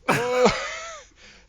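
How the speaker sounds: big burst of laughter; loud high laugh. Real, not acted. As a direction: cackle out loud. GM laughs at the roll.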